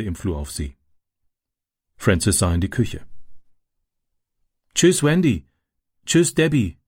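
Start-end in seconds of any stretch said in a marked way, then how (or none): 4.7-6.8 high call of goodbye into the kitchen. A narrator's reading. Boy calls goodbye to his sisters, calling out. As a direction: call farewell across the room. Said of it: "rief er".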